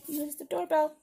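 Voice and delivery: ordinary delivery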